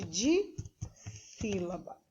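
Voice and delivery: "dissílaba" split after a rising di; silabado. Shown as a demonstration. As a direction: pause inside "dissílaba", after di. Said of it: "tem duas sílabas". ("dissílaba" com pausa depois do di)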